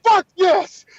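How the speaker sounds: normal talking voice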